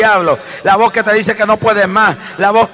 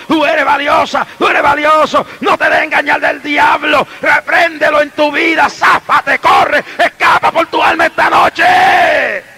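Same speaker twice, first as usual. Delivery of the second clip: preaching shout; 6 semitones higher than usual. Real, not acted. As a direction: shout it to the congregation. Predicador gritando al pueblo. (predicación gritada, aguda y fuerte)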